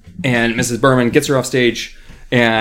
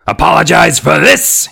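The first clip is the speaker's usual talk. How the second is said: loud, higher nasty puppet scream; screaming dummy; 5 semitones higher, 8 dB louder than usual. A narrator's reading. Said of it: "he screamed".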